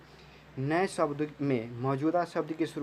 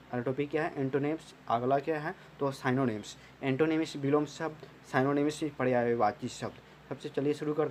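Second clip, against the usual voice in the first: ordinary talk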